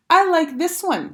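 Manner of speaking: high, slow, smug voice; possessive. A narrator's reading. Sheila claiming the rock from the boys, smug and slow. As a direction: say it as if she owned the park. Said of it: "as if she owned the park".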